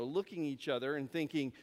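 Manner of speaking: ordinary delivery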